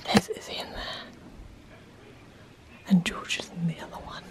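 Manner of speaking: hushed whisper. Real, not acted. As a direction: whisper so they won't hear. Sneaking past getting-ready rooms.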